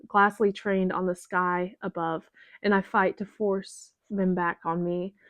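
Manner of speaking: usual speaking voice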